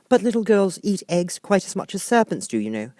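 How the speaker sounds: put-on a truthful child reasoning; lightly high girl voice; lightly voiced little girl. A narrator's reading. Alice argues with pigeon.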